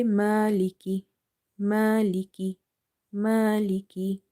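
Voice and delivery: maliki with long drawn vowels; maliki recited with long vowels; stretched maliki. Shown as a demonstration. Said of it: "by stretching".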